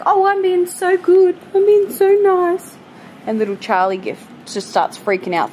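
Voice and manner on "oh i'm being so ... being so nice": high, loud, sing-song voice of a begging dog; dog's inner voice. Retold or imitated. Owner voicing her dogs begging, high and sing-song. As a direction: voice the dogs acting angelic for food. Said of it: "They're like".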